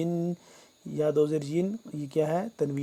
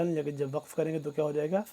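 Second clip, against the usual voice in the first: normal talking voice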